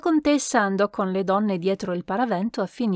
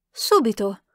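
plain reading voice

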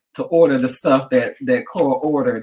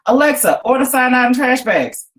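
higher, louder bark at the speaker; shouted command; 10 semitones higher, 4 dB louder than usual. A narrator's reading. Cat Cora shouts an order at Alexa, loud command. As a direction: call it out into the air at the device. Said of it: "Cora shouted into the air".

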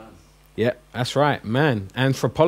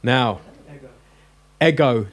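normal talking voice

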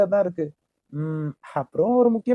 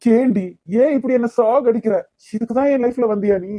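loud, raised pleading outburst; anguished yelling at his love; desperate angry shout; 3 semitones higher, 4 dB louder than usual. Acted. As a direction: throw it at her in despair, loud and high. Heated lovers' quarrel.

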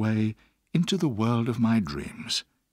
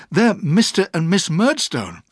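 loud, raised-pitch warning; urgent boy, higher and louder; crying out; 7 semitones higher, 8 dB louder than usual. A narrator's reading. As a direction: cry out to warn her. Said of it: "I cried out".

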